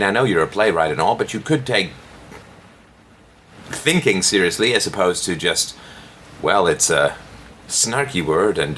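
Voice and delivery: usual speaking voice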